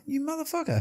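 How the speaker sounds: high squeaky motherfucker, hammed-up; mock indignant curse. Complaining to a friend about the dentist, comic high indignation. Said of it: "It's like".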